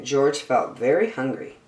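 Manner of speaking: plain reading voice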